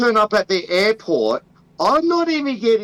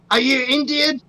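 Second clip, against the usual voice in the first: higher mock officer asking suspiciously; mock customs officer; 6 semitones higher than usual. Officer questions Australian traveller at airport, mock-suspicious higher voice. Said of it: "I'd be like".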